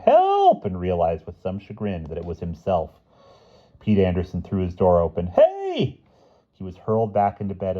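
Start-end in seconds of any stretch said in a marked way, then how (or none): 0.1-0.6 high yell for help. A narrator's reading. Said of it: "heard someone yelling". 5.3-6.0 high startled shout. A narrator's reading. Boy shouts at intruders.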